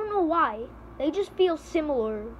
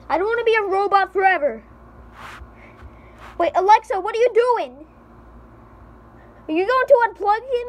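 panicked shouting; 9 semitones higher, 5 dB louder than usual. Pretend. Panics as Alexa unplugs him.

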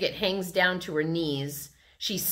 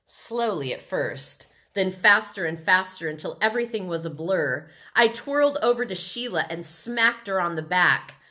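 usual speaking voice

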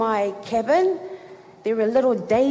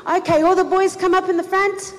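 high, louder leader's call; 8 semitones higher, 4 dB louder than usual. Retold or imitated. Camp leader calls the boys to the front, loud. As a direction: summon the whole room.

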